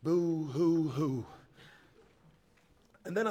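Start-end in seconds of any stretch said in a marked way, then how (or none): 0.0-1.2 slow, drawn-out fake boo-hoo; mock sobbing. A narrator's reading. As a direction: cry like a sorry child, half in jest. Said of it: "I cried for myself".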